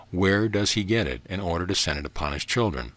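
usual speaking voice

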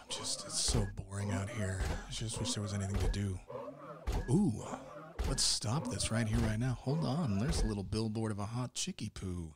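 a pilot muttering to himself on a dull night patrol, lightly voiced; bored night pilot. Night pilot bored on patrol, read softly.

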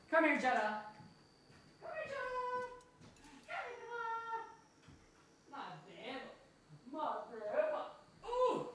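very soft, high pet talk; cooing to dog. Real, not acted. Owner calling his baby over, tiny soft coo.

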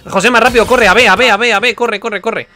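loud, high urging shout; urgent shouting. Real, not acted. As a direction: yell at him to run. Urging teammate to run.

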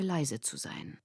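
ordinary delivery

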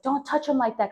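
mildly heated re-enactment of defending someone; mad. Retold or imitated. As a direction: act out indignation without shouting. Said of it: "I got so mad".

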